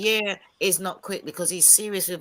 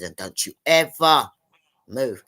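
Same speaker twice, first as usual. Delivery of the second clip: stern warning; 4 semitones lower, 7 dB louder, slower than usual. Retold or imitated. Stern talking-to a rude girl.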